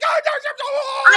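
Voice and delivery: high, loud mock panic cry; mock panicked shout. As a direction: squeal it out like a spooked ghost hunter. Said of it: "you're like".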